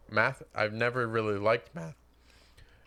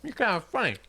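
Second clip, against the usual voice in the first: put-on childish quote; 7 semitones higher, faster than usual.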